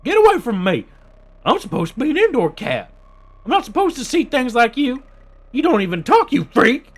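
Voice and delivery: high, loud frantic cat shouting; cornered cat screaming at a monster; Pixel's panicked voice. Acted. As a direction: yell like a scared indoor cat. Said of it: "what was Pixel's voice that I did".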